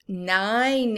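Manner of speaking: usual speaking voice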